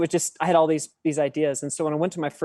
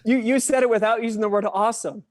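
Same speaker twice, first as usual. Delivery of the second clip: normal talking voice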